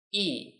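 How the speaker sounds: E falling sharply from high; fourth tone e. Shown as a demonstration. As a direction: drop E hard and short. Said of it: "Let's read them now".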